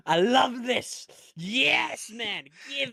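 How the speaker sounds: drawn-out high delight; gleeful. Real, not acted. Host relishing the storyline, high drawn-out delight. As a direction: stretch it out, thrilled.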